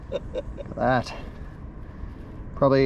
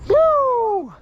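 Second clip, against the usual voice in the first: excited; 3 dB louder than usual. Real, not acted. Fish finally landed.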